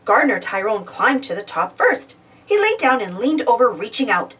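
ordinary delivery, nothing marked

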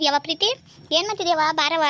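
loud call for his daughter. Acted. Father calling his daughter Preethi, loud call. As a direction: call her out of her room.

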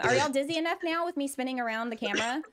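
usual speaking voice

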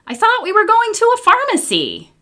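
put-on a confused little girl asking; lightly voiced puzzled child.

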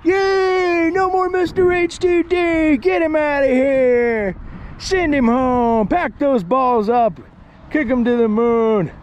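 high, loud mock cheering, slowing down; a hammed-up crowd of haters, high and loud; jeering haters. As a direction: gloat like haters cheering him off. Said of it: "that's the haters talking right there".

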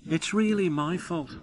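high, sorry admission of blame; sad. A narrator's reading. Oliver owning up to the other engines, sad. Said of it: "said Oliver sadly".

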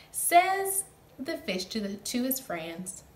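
plain reading voice

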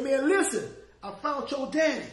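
can't contain the joy; retold joyful announcement; excited news. Telling the good news.

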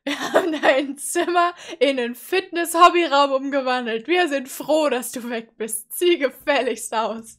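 loud, emphatic mock parent rant. Reactor playing parents kicking June out, loud mocking rant. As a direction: throw the kid out with loud relish.

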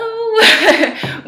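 a sudden high, loud burst of laughter; nervous high laugh. Real, not acted. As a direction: laugh from welling emotion. Laughs on the way to tears.

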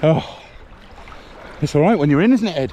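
loud, high and thrilled; calling out over the rushing water; excited river ride. Real, not acted. Floating down fast river.

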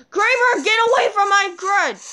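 high, loud yell; high, loud, exasperated yell at a mob. Real, not acted. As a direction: shout him off your stuff. Yelling at a mob.

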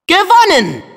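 high gleeful victory cry; triumphant. Acted. The duck celebrates winning the fight, high triumphant cry. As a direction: crow it out like you just won.

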